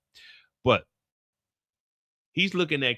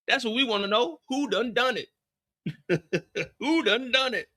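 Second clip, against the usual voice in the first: Kanan voice; 5 semitones higher than usual. Retold or imitated. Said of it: "he does tell her".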